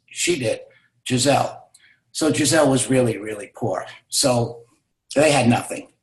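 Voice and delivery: usual speaking voice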